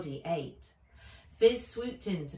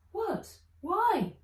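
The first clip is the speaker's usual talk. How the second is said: high, startled questions; shocked girl; 6 dB louder than usual. Acted. Nat shocked by the lost hours, high. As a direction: blurt it out in disbelief.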